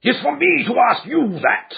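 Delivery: the fat man shrieks at the intruders; shrieked. Acted. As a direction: shriek it back at him. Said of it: "he shrieked".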